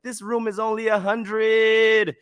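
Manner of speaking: high, stretched mock disbelief; imitated shocked outcry. Man revealing the real room price, imitated high wail. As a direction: keep the mimicked wail high and slow.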